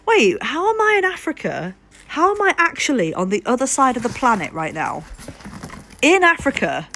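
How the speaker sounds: high-pitched, incredulous retelling of own bewilderment; retold disbelief. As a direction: relive being baffled, pitch leaping up.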